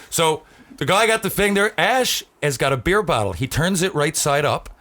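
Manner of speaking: ordinary delivery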